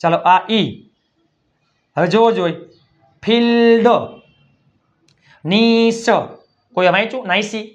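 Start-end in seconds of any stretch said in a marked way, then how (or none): 3.2-3.9 ફિલ્ડ loud and higher, vowel held; teacher models ફિલ્ડ with a stretched ee; long ee sound. Shown as a demonstration. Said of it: "આઈનો ઉચ્ચાર ઈ થાય". 5.4-6.1 નીસ with the ee held long; long ee sound. Shown as a demonstration. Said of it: "આઈનો ઉચ્ચાર ઈ થાય".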